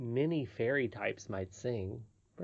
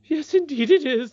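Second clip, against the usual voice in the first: high, loud acted distress; starting to cry; 11 dB louder than usual. Nurse admitting they broke the law, tearfully. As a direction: wail it as an upset nurse. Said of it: "beginning to cry again".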